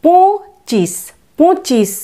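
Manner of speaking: পঁচিশ, নাসিক্য পঁ; nasal po. Shown as a demonstration. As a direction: model পঁচিশ slowly, nasal পঁ. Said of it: "পয়ের নাসিক্য উচ্চারণ".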